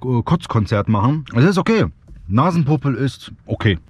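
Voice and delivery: ordinary delivery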